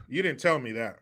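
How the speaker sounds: ordinary delivery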